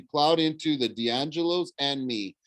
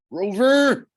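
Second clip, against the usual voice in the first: high, loud cry for help; desperate call; 13 semitones higher, 8 dB louder than usual. A narrator's reading. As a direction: call out desperately to a far-off friend. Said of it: "I thought desperately".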